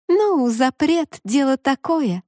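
high, slow playful mermaid voice; laughing mermaid. Acted. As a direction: tease him with a light laugh in the voice. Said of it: "рассмеялась девушка".

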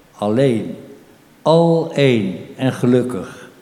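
measured poetry reading with voiced dialogue; poem recital. Poet reads his poem about an unborn soul to the audience, measured recital.

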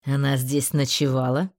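cold, low, clipped question; icy voice. Acted. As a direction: ask it with icy control. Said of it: "ледяным голосом уточнил Джеттон".

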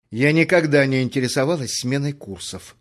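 plain reading voice

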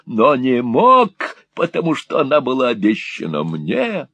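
high, loud mocking old devil; devilish glee. A narrator's reading. Gride mocking rejected suitor, devilish high glee. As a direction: gloat like a devil.

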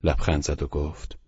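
ordinary delivery

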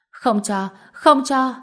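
put-on childish protest rising to a high shout. A narrator's reading. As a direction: build from insistence to a yell. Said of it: "Hân Hân càng khóc to hơn".

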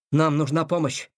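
ordinary delivery, nothing marked

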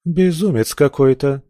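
a passing woman recoils; startled woman. Acted. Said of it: "сказала проходящая мимо ... пышная женщина".

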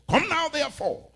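God's voice thundered high and loud; a sudden booming proclamation; booming call. Acted. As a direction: ring it out like a divine command. Preacher voicing God's call.

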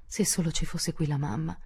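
plain reading voice

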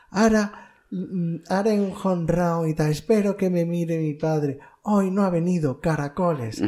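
put-on high, eager little boy voice, lifted well above his own; kid in an ad. Recalling a cinema ad, imitating the boy hoping dad watches. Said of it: "Decía".

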